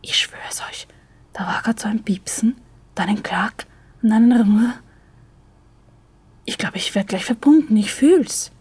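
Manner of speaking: eager half-whispered report; hushed excitement, barely containing it; man whispers back excitedly about the phone line. A narrator's reading. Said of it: "Mit aufgeregter Stimme flüstert Steffen zurück".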